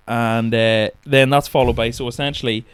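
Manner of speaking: ordinary talk, nothing marked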